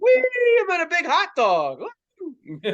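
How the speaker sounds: high, squealing pretend glee; mock glee. Passenger enjoying the cushioned bus, gleeful. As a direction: cheer like riding a silly ride. Joking about joyride on a bus.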